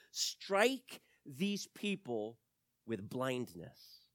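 slow, drawn-out solemn command; solemn prayer. Acted. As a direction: pray it slowly and gravely. Elisha prays slowly.

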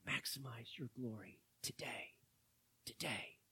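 whispering surrender to God; whispered prayer. Acted. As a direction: breathe the prayer out.

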